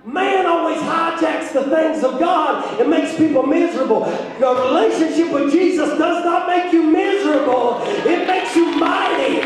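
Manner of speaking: driving sermon climax; full-force preaching. Acted. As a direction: preach with urgent, ringing conviction.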